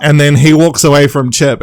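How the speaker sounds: ordinary talk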